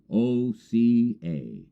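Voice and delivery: normal talking voice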